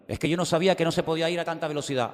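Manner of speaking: high, quick pleading of innocence; whiny excuse. Retold or imitated. Driver excusing himself to the officer, high and fast whining.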